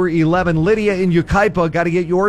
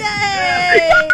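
excited shout; 3 dB louder than usual. Real, not acted. Host cheers the correct answer.

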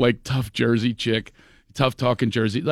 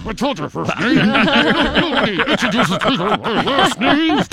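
higher, slow, laughing through a fixed smile; ventriloquist back; 8 semitones higher, 4 dB louder than usual. Retold or imitated. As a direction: answer without moving your lips. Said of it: "I'm doing the ventriloquist back going".

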